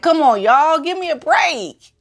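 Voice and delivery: high, pleading mock Harry voice; mock Harry plea. Harry begging everyone to give him a break, high and whiny. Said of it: "He's like".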